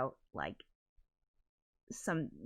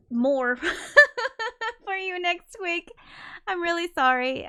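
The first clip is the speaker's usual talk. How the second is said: laughing apology; 10 semitones higher, 8 dB louder than usual. Real, not acted.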